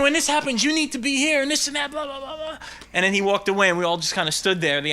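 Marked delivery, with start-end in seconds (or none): 0.0-2.6 higher-pitched imitation of a scolding; mock director yelling. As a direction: hector them, then drift into babble. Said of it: "we got yelled at by the director".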